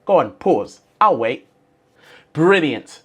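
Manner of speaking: ordinary talk, nothing marked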